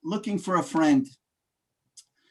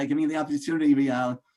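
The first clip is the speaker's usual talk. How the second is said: ordinary talk, nothing marked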